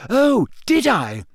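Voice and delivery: high, slow, offended challenge; Hermione, dangerous. A narrator's reading. Hermione challenged by Ron's disbelief, dangerously indignant. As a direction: challenge him with rising indignation. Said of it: "her eyes flashed dangerously".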